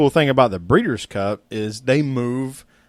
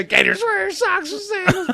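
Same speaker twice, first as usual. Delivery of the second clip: imitating screaming toddler. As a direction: squeal like a little kid. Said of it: "Three-year-old just screaming that".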